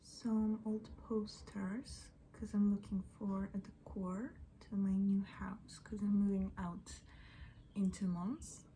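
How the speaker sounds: ordinary talk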